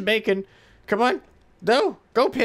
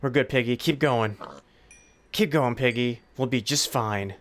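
ordinary talk, nothing marked

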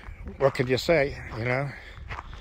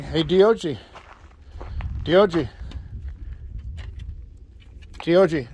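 calling out; 3 semitones higher than usual. Real, not acted. Calling dog to come.

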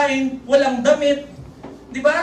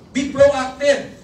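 Be proactive stretched out slowly; drawn-out Be proactive; slow slogan; 3 dB louder, much slower than usual. Shown as a demonstration. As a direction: lay out each word of Be proactive. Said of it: "teaching a slogan".

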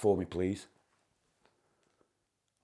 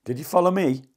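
calling out; 4 semitones higher, 6 dB louder than usual. Real, not acted. Calling out to a ghost.

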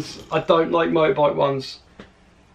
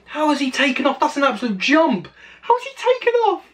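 high-pitched, incredulous exclamation; astonished disbelief; 8 semitones higher than usual. Real, not acted. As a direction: squeal it in sheer amazement. Astonished by a jump on video.

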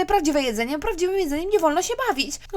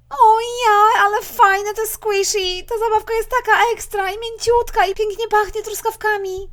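gushing; 4 semitones higher, 5 dB louder, slower than usual. Acted. Mother gushes over toy.

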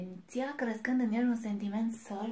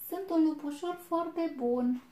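high, loud little-wolf voice; a small wolf insisting proudly; thin little voice; 8 semitones higher, 8 dB louder than usual. A narrator's reading. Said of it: "cu vocea lui subțirică".

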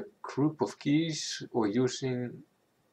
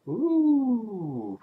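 impressed ooh; 12 semitones higher, 4 dB louder than usual. Real, not acted. Delighted by lighting effect.

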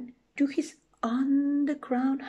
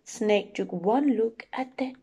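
usual speaking voice

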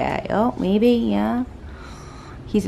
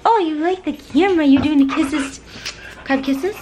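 pet talk; 10 semitones higher than usual. Real, not acted. Owner asking her dog for kisses, high cooing pet talk.

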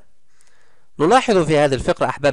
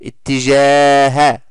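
اتجاه read slowly and louder, the long alif stretched; slow drawn-out vowel; 6 dB louder, much slower than usual. Shown as a demonstration. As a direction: draw out the vowel for the class. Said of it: "مد الألف موجود". (مدّ طويل بطيء بصوت أعلى)

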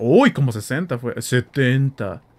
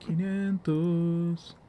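drawn-out repeat; 5 semitones higher, 5 dB quieter, much slower than usual. Real, not acted.

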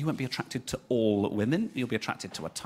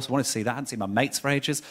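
usual speaking voice

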